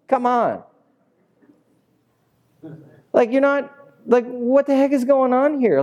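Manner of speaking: high-pitched scoffing neighbor; incredulous grumbler. Acted. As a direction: protest in a high, indignant voice. Acted crowd scoffing at Jesus.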